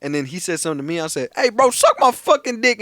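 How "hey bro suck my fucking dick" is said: high, loud jokey trash talk; playful shouted insult; loud mock taunt. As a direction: bark it across the game chat. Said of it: "Bro, y'all good".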